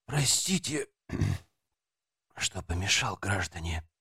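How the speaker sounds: low, soft hoarse apology. A narrator's reading. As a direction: rasp it out quietly. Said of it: "сиплым голосом произнёс старший сержант".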